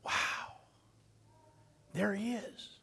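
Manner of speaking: the marveling crowd; awed whisper. Retold or imitated. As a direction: marvel under your breath. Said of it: "everybody marveled at that".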